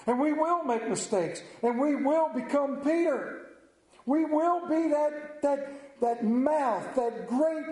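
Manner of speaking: high, driving sermon cry, slowing on lunkhead; preacher rousing the church about Peter's flaws; preaching at full force. Acted. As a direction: build it to a fervent peak. Preacher building to climax.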